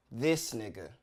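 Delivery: low, falling, unimpressed punchline; unimpressed catchphrase. Acted. Comic capping a gripe about a seat neighbor, unimpressed. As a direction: drop the voice in disbelief.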